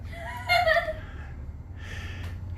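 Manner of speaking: high breathy giggle; laugh. Real, not acted. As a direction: laugh at yourself. Reader laughs at own voice.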